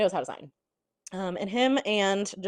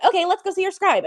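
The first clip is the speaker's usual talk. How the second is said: high mock chirpy; 11 semitones higher, 6 dB louder than usual. Zayden voiced in retelling.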